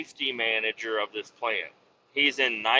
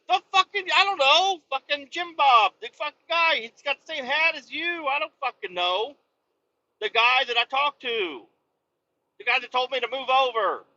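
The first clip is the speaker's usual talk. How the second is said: much higher, frantic exasperated voice; flustered retold reply; 12 semitones higher than usual. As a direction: sputter it like you're panicking.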